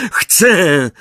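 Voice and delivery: high, defiant cry; shouted. A narrator's reading. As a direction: cry it out in answer. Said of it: "krzyknął Harry".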